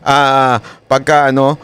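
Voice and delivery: normal talking voice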